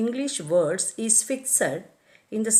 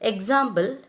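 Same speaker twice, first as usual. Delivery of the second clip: normal talking voice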